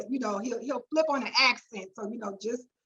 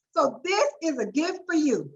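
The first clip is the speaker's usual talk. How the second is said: ordinary talk, nothing marked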